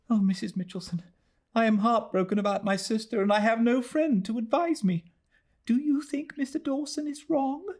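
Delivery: high, anxious plea of a heartbroken lady; young lady near tears, pitched well up; distressed young lady. Acted. Narrator voices distressed Lady Glyde.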